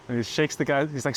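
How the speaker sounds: ordinary talk, nothing marked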